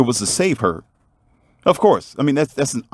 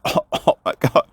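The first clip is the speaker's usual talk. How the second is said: imitated coughing; 6 semitones higher than usual. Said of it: "he was coughing".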